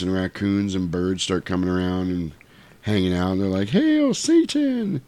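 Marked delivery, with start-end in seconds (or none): 3.7-5.0 high, drawn-out cheery chant; cute critters. Retold or imitated. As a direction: cheer it as tiny squeaky animals. Cute woodland animals cheering Satan.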